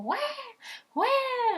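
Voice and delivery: high imitated baby wail; newborn crying wah. Mother imitates her newborn's first cry, high wail. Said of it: "and she was like".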